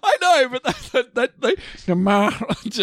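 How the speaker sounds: words broken up by high giggles; laughing. Real, not acted. Still giggling at his friend's Maharaja impression. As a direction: try to talk but keep breaking into laughter.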